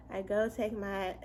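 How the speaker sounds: ordinary talk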